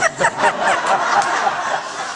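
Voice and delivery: very high, loud squeal of laughter; shrieking laugh. Acted. Laughing at flush-twice joke.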